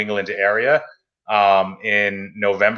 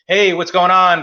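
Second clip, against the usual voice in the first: friendly greeting; 8 semitones higher, 5 dB louder, slower than usual. Retold or imitated. Retelling phone call to shop owner.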